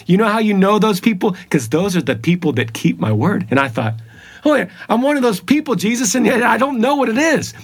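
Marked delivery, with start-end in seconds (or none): 4.5-7.5 higher, faster, eager recognition; excited realization. Retold or imitated. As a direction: burst out as it dawns on you. Realization quoted to Jesus.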